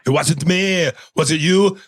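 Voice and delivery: bickering in put-on celebrity voices; arguing passengers. Back-seat guys argue about who farted, caricature voices. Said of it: "the guys are arguing in the back".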